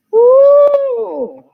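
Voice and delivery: very high, loud whoop of delight; a burst of joy at the card; excited woo. Real, not acted. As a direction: cheer like the card thrilled you. Excited over the card pulled.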